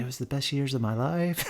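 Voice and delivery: hushed, earnest mock voice; softly imitating a wistful athlete; soft-spoken put-on sincerity; Tim Tebow. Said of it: "in a Tim Tebow voice".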